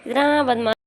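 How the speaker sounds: high, loud playful scolding of a little rascal; fond teasing. Real, not acted. Mother teasing her naughty baby.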